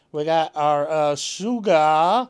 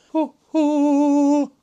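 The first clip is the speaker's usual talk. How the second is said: playful high hoot, a touch louder; 10 semitones higher, 6 dB louder than usual. Real, not acted. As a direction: let out a high, playful hoo-hoo. Playful exclamation after tune.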